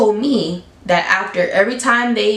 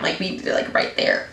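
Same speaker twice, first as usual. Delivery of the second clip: unvoiced, much softer whisper; whispered confession; 3 semitones lower, 6 dB quieter than usual. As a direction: whisper it like a naughty secret. Roommate confessing secret.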